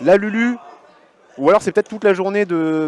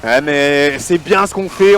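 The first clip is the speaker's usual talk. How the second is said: ordinary talk, nothing marked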